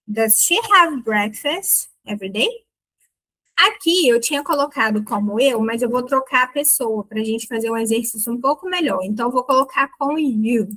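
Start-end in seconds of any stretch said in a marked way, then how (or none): none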